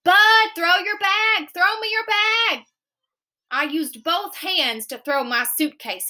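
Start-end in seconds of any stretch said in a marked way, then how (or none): 0.0-2.6 high, slower urgent call; scream. A narrator's reading. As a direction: yell across to a running friend. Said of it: "He screamed".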